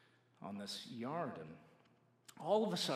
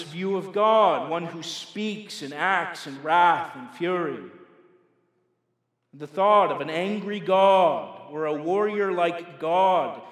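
loud, emphatic pulpit delivery; forceful preaching; 4 semitones higher, 13 dB louder than usual. Real, not acted. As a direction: drive each point home to the congregation, loudly. Preacher presses the point.

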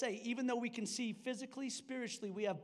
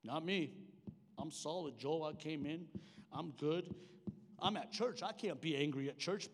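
low-voiced, put-on assurance; mock denial; 6 semitones lower, 4 dB quieter than usual. Preacher imitating churchgoer who claims to be fine, mock denial. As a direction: insist you are fine, pitched down. Voicing a congregant denying anger.

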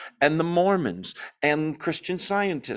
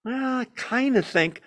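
higher, hesitant mock waffle; mock waffling; 9 semitones higher than usual. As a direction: play the unsure wimp he is not. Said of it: "notice he doesn't waffle".

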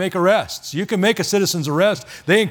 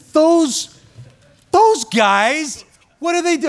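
a mock slow-witted voice, higher and louder, for a little thick preacher; playing dumb; 11 semitones higher, 3 dB louder, much slower than usual. Preacher mocking himself as thick, voicing a playing-dumb question. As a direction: ham up being clueless to the congregation. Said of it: "I'm a little thick upstairs".

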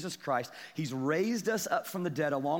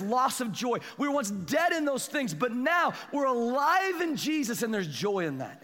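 high, driving pulpit proclamation; full-force preaching; 7 semitones higher, 4 dB louder than usual. Real, not acted. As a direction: build insistently on each repeated phrase.

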